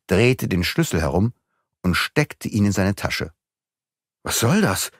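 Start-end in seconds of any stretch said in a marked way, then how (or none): 4.2-4.9 mildly startled question; a touch of alarm, understated; taken aback; surprised call. A narrator's reading. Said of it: "rief Beautrelet völlig überrascht".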